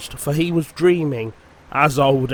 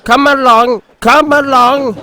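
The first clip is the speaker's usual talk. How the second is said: much higher, louder huffing call; puffing; 9 semitones higher, 7 dB louder than usual. A narrator's reading. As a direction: puff out each word. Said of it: "he puffed".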